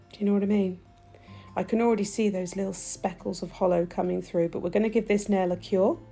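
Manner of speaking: ordinary talk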